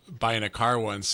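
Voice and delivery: ordinary talk, nothing marked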